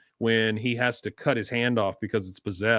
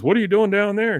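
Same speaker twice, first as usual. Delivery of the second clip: higher, louder surprised question; Hank surprised; 8 semitones higher, 6 dB louder than usual. Retold or imitated. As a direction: call down behind the counter. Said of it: "he goes".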